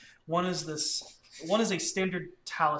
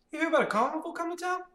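townsperson voice; 14 semitones higher, 5 dB louder, much faster than usual. Acted.

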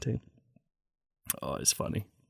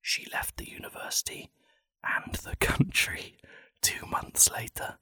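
ASMR whisper; 5 semitones higher, 5 dB quieter than usual. Pretend. Comedian reading a sad story like it's ASMR, whispering. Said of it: "like it's A S M R".

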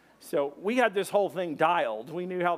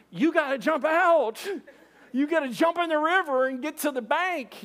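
high, raised-voice instruction; urgent calling out; 10 semitones higher than usual. Acted. Teen urging friend off the truck roof, urgent. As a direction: urge him over the roaring water.

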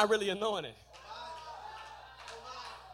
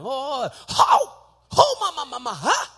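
mock tongues holler, high; lightly voiced church mother crying out; imitating a praying mother; 3 semitones higher, 9 dB louder, slower than usual. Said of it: "that's what she would holler".

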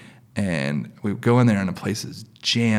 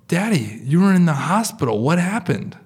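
higher, lightly imitated child's voice; nine-year-old daughter; 5 semitones higher than usual. Daughter in back seat asking dad, nine-year-old's voice. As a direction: ask it like a worried little girl. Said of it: "my nine-year-old from the back, she goes".

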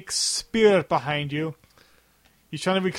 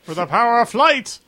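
dramatic shout; 7 semitones higher, 5 dB louder than usual. Acted. Dramatic movie quote.

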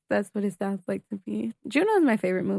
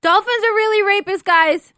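sudden loud outburst; 10 semitones higher, 6 dB louder than usual. Real, not acted. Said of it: "You sound like you have, um, Tourette's".

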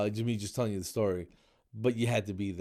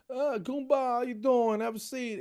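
much higher, hammed-up New Jersey Italian greeting; Italian-American pizza man; 4 dB louder than usual. Old pizza man greeting a customer, thick Italian-American accent. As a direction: welcome him like the old pizzeria owner. Pizzeria owner greeting him.

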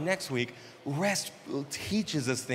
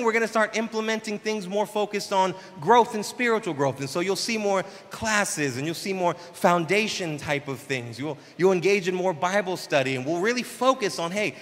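ordinary delivery

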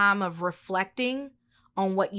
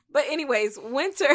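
laughing; 6 semitones higher, 3 dB louder, faster than usual. Real, not acted. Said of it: "I can't stop laughing".

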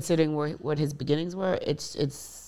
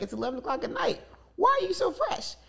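rising high, teasing accusation; teasing disbelief; 12 semitones higher than usual. Retold or imitated. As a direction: poke fun at dad, going up high at the end. Teen teasing dad about cologne.

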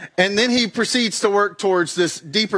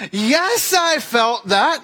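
higher pitched, slowed, pained yes; pained reply; 6 semitones higher, much slower than usual. Retold or imitated. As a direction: let the hurt leak into a slow answer. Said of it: "I was like".

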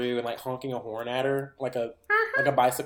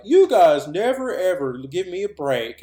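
loud, slow, higher-pitched mock complaint; exasperated morgue guy; 5 semitones higher, 7 dB louder than usual. Morgue guy complains to his coworkers, mock exasperation. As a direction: drag out the gripe, hamming it up.

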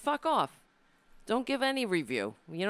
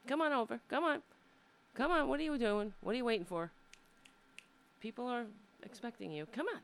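higher, sing-song calling; coaxing the pet; 6 semitones higher, faster than usual. Real, not acted. Host calling her pet over to the camera, higher and coaxing. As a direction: coax him over playfully.

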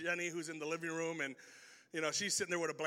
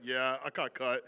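low, unbothered shrug of a voice; totally cool injured kid; calm like a boss; 8 semitones lower than usual. Retold or imitated. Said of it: "he handled it like a boss".

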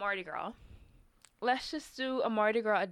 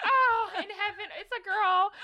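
high, loud squeal of joy; mock gushing over happy news, laughing; overjoyed; 12 semitones higher, 5 dB louder than usual. Friend retelling a gender reveal, overjoyed. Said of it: "I'm just so overjoyed".

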